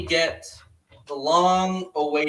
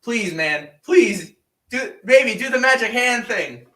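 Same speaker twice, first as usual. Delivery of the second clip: pleading; 5 semitones higher, 6 dB louder than usual. Retold or imitated. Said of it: "I was like".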